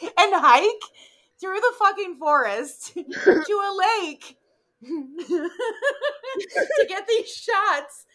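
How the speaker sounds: high, slow words through laughter; laughing. Real, not acted. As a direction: laugh through every word.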